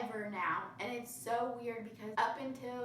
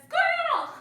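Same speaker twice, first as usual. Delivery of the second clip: much higher, much louder yelp of a distracted dog; cartoon dog voice; 12 dB louder than usual. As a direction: bark "Squirrel!" like the dog from Up, pointing. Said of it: "a dog who points and says".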